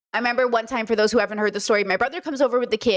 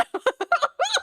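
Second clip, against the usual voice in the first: a high squealing laugh; high burst of laughter. Real, not acted. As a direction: crack up at your own story. Cracking up at own story.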